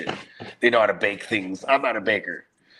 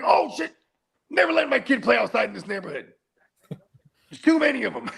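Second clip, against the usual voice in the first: high-pitched comic panic; alarmed father; 12 semitones higher than usual. Retold or imitated. Dad seeing the neighborhood map, high alarmed outburst. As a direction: react high and aghast, voice jumping up.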